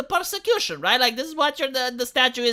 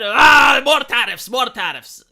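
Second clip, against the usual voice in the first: mock outraged yell, loud burst then easing; hammed-up tantrum opening on a big roar; upset Trump; 2 semitones higher, 10 dB louder, slower than usual. Trump raging at Canada trading with Europe, mock angry yell. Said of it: "Trump was upset".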